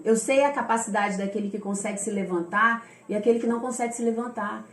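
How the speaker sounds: normal talking voice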